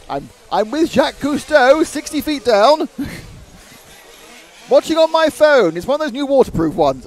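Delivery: loud, high mock report; hammed-up answer from the seabed; pretend caller underwater.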